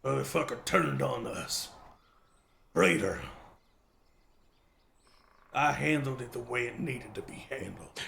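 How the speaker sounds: a hint of a hard old farmer, cold; gruff farmer. Old farmer telling the group he killed the traitor. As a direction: flatly justify the kill.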